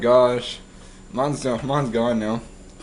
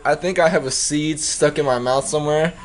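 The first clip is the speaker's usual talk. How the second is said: ordinary talk, nothing marked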